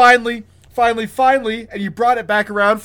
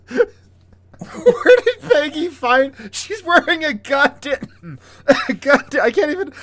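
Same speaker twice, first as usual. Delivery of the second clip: high, incredulous outrage breaking into laughter; real worked-up, laughing rant; too upset to talk; 7 semitones higher, 4 dB louder than usual. Real, not acted. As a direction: splutter in outrage, laughing helplessly. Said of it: "I'm so upset I can't even talk".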